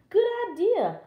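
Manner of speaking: put-on high-pitched girl's agreement; high, delighted girl voice; eager Little Red. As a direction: agree keenly as a trusting child.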